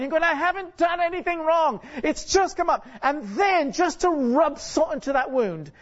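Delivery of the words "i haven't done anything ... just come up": high-pitched mock exasperation; acted frustrated whine at a higher pitch; frustrated protest. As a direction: protest your innocence, exasperated. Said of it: "you're really frustrated".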